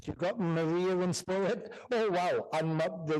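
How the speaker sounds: ordinary talk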